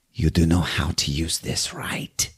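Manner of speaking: low, quiet menace, imitated; dead serious. Gunman holds up his gun to the duck, softly acted seriousness. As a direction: drop the smile and say it softly. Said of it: "He is serious as hell".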